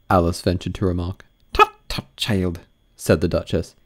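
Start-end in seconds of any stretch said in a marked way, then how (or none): 1.5-2.6 imperious plummy grande dame. Acted. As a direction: purr like a grand old duchess. Duchess voiced.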